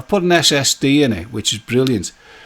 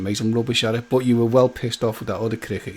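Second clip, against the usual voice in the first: ordinary talk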